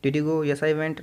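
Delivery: ordinary delivery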